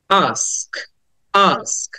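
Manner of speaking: "ask" as a held "aask", falling; "ask" with a long "aah" before "sk"; long open "aa". Shown as a demonstration. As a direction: open the mouth and stretch the vowel of "ask". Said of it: "আটা long করে বলতে হবে".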